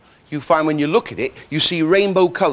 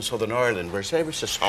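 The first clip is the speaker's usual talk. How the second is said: soft, low Southern Irish lilt; 7 semitones lower, 6 dB quieter than usual. Shown as a demonstration. Explaining accents to the host, lightly done soft Southern Irish. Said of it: "accents can be very soft".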